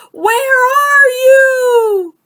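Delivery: slow, high, loud searching cry; calling out. Acted. Toad searches for Frog, slow loud high call. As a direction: stretch it out, searching. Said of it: "cried the thing".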